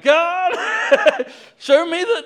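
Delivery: very high, loud hammed-up whine to God; comic tantrum at full volume; frustrated yell. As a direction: wail it in mock exasperation, breaking into laughter. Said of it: "I get so frustrated by this".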